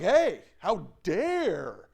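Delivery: slow, indignant how-dare-you, put on; mock anger. Preacher voicing someone angry at being opposed, mock indignation. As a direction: act affronted, stretching out the outrage. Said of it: "How many just get angry, mad, hateful, spiteful".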